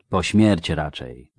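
ordinary delivery, nothing marked

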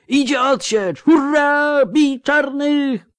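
very high war cry, drawn out at the end; exultant yell. A narrator's reading. Old soldier announcing relief and urging attack, yelling. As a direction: scream the charge across the field. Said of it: "wrzasnął de Ruyter".

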